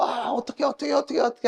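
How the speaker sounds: high-pitched, flustered mock fretting; mock panic. Preacher mimics someone panicking after sleep paralysis, high mock fluster. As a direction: act out helpless panic in a high voice.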